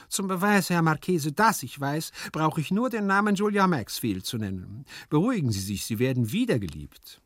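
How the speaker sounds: high, lively servant voice; knowing servant figure. A narrator's reading. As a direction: prove what you know to the master. Hyazinth voiced in the story. (wissender Diener, hohe Stimme)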